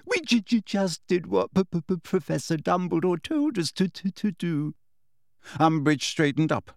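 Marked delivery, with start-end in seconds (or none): 0.1-4.7 higher, stuttering, weepy; tearful. A narrator's reading. Hermione explaining to Umbridge, tearful stammer. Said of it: "sniffing loudly".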